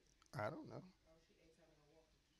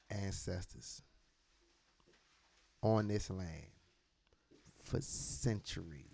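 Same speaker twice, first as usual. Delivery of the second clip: ordinary talk, nothing marked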